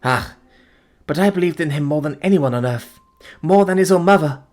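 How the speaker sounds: high, loud, rising lament; passionate grief. Acted. Fiancée confessing her devotion, high passionate lament.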